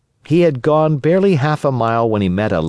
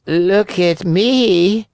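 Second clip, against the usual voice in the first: high, very slow cat voice; high, drawn-out storybook cat; 11 semitones higher, 3 dB louder, much slower than usual. A narrator's reading. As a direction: stretch it out in a high feline whine. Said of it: "said the cat".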